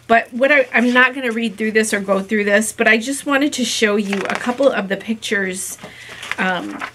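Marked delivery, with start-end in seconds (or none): none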